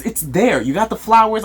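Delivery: usual speaking voice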